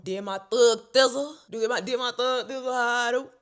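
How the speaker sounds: loud, high, hyped-up boast; hype. Retold or imitated. Bragging about her pre-test ritual, loud and hyped. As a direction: pump it up like a victory chant.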